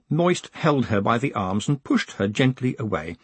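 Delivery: ordinary delivery, nothing marked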